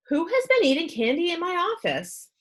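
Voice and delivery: doctor sniffing out candy, mock indignant; demanding. A narrator's reading. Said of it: "he demanded".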